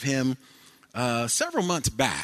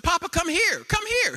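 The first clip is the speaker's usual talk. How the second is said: put-on very high, loud little boy crying out; preacher imitating his grandson's delighted yell; excited toddler shout; 3 dB louder than usual. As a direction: shout it like a thrilled three-year-old. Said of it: "He said".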